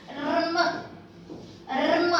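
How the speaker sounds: "rm" with a rolled r leading into m; R before M. Shown as a demonstration. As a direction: sound the r first, then m, in "rm". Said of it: "the R is pronounced first".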